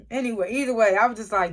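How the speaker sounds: ordinary talk, nothing marked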